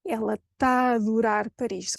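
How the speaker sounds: "tá a adorar" fused with one long open A; connected "ela taadorar Paris"; merged triple A. Shown as a demonstration. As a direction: run the three A's of TÁ A ADORAR into one. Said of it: "triple A unites forces and becomes".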